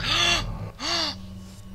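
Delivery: sharp frightened gasp; startled gasp. Real, not acted. Gamer sees the monster and gasps in shock.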